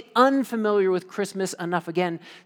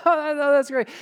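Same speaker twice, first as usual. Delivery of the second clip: ordinary delivery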